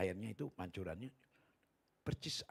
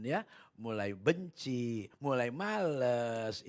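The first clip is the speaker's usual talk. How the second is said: slow emphasis; 5 dB louder, much slower than usual. Real, not acted. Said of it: "slow emphasis".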